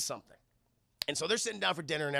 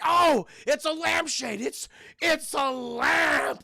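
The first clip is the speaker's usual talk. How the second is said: high, loud, slowly stretched words; hammed-up triumphant dad; delighted revelation; 9 semitones higher, 6 dB louder, much slower than usual. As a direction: draw it out in awed glee. Dad discovers it is a lamp.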